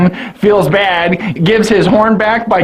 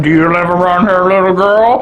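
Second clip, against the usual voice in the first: lightly voiced creepy man, quick; a hint of a sleazy stranger; mocking the baron. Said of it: "mocking him".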